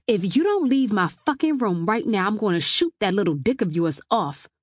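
heated, forceful threat; angry threat. Acted. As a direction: warn the intruder to get out now.

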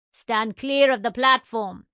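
booming Jamaican announcer over the speakers; loud Jamaican platform announcement; Jamaican accent. Acted. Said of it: "boomed over the speakers in a Jamaican accent".

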